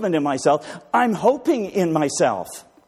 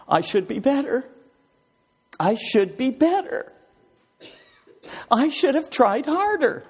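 higher, pleading self-reproach; a hint of a worried striver; anxious inner voice; 13 semitones higher than usual. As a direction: fret like someone who can't forgive himself. Voicing self-critical inner thoughts.

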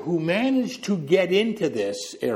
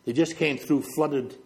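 ordinary talk, nothing marked

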